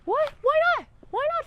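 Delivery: high, pleading daughter voice; mock whiny daughter. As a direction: whine up high like a shocked girl. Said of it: "the daughter comes in and she's like".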